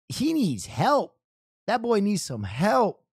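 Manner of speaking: ordinary delivery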